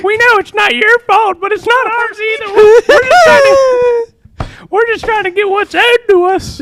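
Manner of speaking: put-on very high old man, rising to a cracking cry; grandpa voice. Grandpa pleading with the manager over the phone. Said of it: "the grandpa voice".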